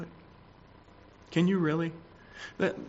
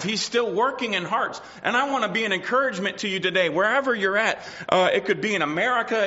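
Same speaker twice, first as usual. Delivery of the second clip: raised, ringing pulpit delivery; preaching at full force; 7 semitones higher than usual. Acted. Preacher exhorting the congregation, in full preaching voice. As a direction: proclaim it to the whole church.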